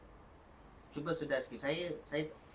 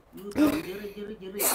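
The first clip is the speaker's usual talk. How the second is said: tearful accusation; 6 semitones higher, 7 dB louder than usual. Acted. Accusing her of screaming.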